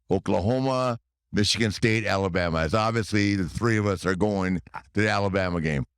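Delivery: ordinary delivery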